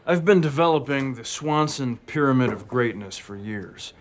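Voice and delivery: ordinary talk, nothing marked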